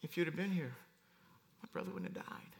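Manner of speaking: falling to a soft whisper on the second half; Mary breaking down, barely whispering; grieving sister whispering at Jesus' feet; broken whisper. Acted. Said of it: "And here comes Mary".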